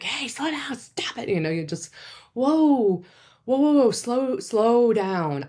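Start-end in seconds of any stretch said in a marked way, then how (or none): 0.0-1.3 curt, higher snap that drops to a hiss; harsh snap. Shown as a demonstration. What not to say to a raging partner, curt snap. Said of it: "instead of, like". 2.3-5.4 louder, higher, steady whoa to calm them; firmly but kindly slow them down. Shown as a demonstration. Calming a partner mid rage, loud firm whoa. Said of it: "kindly and compassionately, but firmly".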